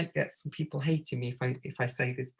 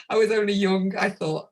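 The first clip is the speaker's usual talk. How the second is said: ordinary talk, nothing marked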